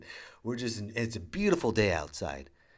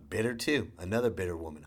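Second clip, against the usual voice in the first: ordinary talk, nothing marked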